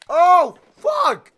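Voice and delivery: loud, high drawn-out curse; shocked yell. Real, not acted. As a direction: blurt it out in real shock. Getting hit hard in game.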